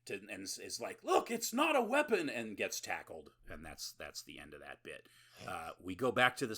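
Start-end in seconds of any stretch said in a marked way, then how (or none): 1.0-2.3 high, loud acted plea; mock panicked protest. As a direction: yelp it like a man facing armed cops. Acting man caught in police raid.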